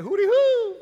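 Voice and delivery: very high, loud hooting call; homeboy's call. Retold or imitated. A homeboy calling out a warning to his friend, high and loud hoot. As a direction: hoot it out like a friend signaling trouble. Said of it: "my homeboy said".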